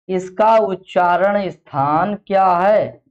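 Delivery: slow, deliberate classroom prompting; slow, drawn-out question. Real, not acted. Teacher prompting the class on the place of articulation, slow and drawn-out. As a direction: stretch out उच्चारण and स्थान, word by word.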